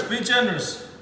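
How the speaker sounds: normal talking voice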